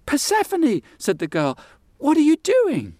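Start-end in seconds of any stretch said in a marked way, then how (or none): 0.1-0.8 high startled calling of a name; surprised call. Acted. Girl calls out in surprise. 2.0-2.9 surprised. Acted. Girl asks in surprise.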